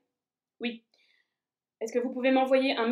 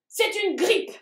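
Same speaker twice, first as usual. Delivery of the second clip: high soldier voice; 6 semitones higher, 5 dB louder, slower than usual. Acted. Dendritic cell announces diagnosis.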